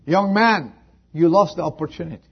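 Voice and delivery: fast, loud and high, stress on lost; preacher's stern declaration; loud rebuke. Acted. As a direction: fling it at him. Same words repeated, soft then loud.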